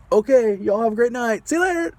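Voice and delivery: high, loud, overly bright send-off; forced cheerful goodbye. Retold or imitated. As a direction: chirp a fake-cheery goodbye and get out. Driver fleeing with forced cheer.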